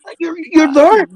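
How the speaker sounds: squeaky-high, exasperated teasing; high-pitched disbelief. Real, not acted. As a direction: pitch it right up in mock exasperation. Teasing Benny for being everywhere.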